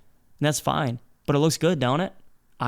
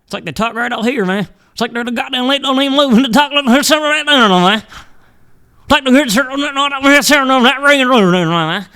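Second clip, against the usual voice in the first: much higher and louder mock Southern accent; thick Southern accent; 9 semitones higher, 7 dB louder than usual. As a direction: lampoon thick Southerners, shouting it out. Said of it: "the thick Southerners".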